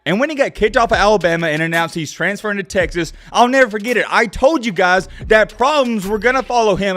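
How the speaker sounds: ordinary talk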